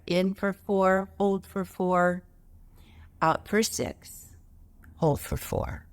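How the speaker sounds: slow, measured breath-count guidance. Shown as a demonstration. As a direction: count the breath out slowly, leaving space. Demonstrates a counted breathing exercise.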